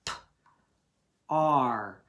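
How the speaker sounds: "P, R" as a quick p sound, long pause, then "ar". Shown as a demonstration. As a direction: tap out "P, R" sound by sound. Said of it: "Tap it".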